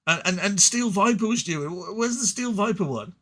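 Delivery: hammed-up fan whinging, slowing on the first question; whiny complaining fans. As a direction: mimic the fans pestering for their faction. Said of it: "And then everyone said".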